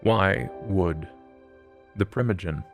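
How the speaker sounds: ordinary talk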